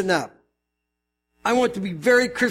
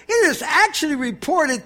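higher-pitched fervent reading; Paul's fervency; 6 semitones higher than usual. Acted. Pastor voices Paul's letter to the church, fervent. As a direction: read it ticked off, with fervor. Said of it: "can you hear the fervency in Paul's voice".

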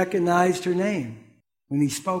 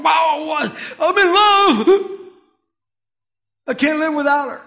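excited, loud, smitten cry of a man in love; lovestruck exclamation; 6 semitones higher, 5 dB louder than usual. Retold or imitated. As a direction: burst out like a man who just fell head over heels. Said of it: "And one day, this one".

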